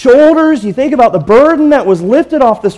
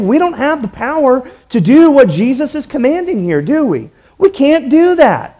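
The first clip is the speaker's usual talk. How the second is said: higher, driving pulpit voice; impassioned preaching; 5 semitones higher than usual. Acted. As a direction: preach it out with rising fervor. Preaching at full force.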